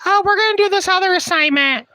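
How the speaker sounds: high, prissy mock-teacher voice. Mocking the teacher who set the assignment, high put-on voice. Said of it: "she's like".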